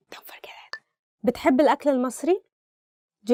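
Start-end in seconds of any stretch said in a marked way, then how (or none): 0.1-0.8 whisper. Acted. Whispered aside after the plug.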